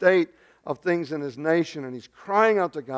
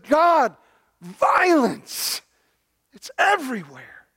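high, loud outcry with heavy pauses; anguished cry; 8 semitones higher, 4 dB louder, slower than usual. Acted. Prophet seeing violence cries to God, anguished. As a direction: cry it out from the heart, word by word. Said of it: "it's an anguished cry".

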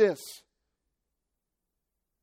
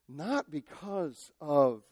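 ordinary talk, nothing marked

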